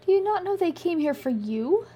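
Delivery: high, disbelieving question; can't believe he doesn't know; incredulous. A narrator's reading. Ileana questioning Aki in disbelief, incredulous.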